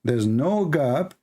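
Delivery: ordinary delivery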